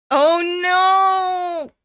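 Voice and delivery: loud, high drawn-out cry; dismayed outcry. Real, not acted. Reader reacting to the comic's gag, dismayed outcry.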